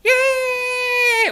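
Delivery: high, loud cheer; excited yay. Acted. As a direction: cheer the crowd in. Puppet show opens, cheering.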